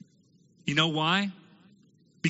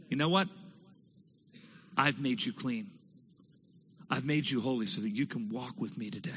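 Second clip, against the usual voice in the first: much softer, gentle promise; tender reassurance; 5 semitones lower, 6 dB quieter than usual. Acted. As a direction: reassure him quietly like a loving father. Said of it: "And God said".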